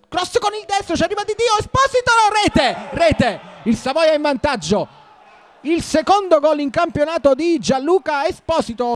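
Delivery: high, loud shouting of rete; breathless italian goal commentary. Real, not acted. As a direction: shout the goal at full tilt. Goal called. (telecronaca del gol senza fiato)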